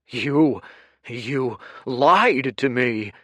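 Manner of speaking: loud, slow, venomous accusation; spat. Acted. Kuruk accusing Dorian of lying, spitting with rage. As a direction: spit the words at him. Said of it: "Kuruk spat".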